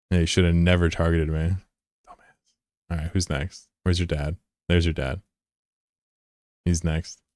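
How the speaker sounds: ordinary talk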